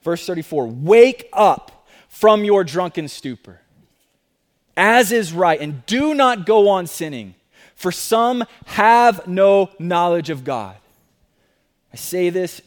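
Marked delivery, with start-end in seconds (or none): 0.7-10.8 scripture reading. Acted. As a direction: proclaim the verse deliberately. Reading scripture verse aloud.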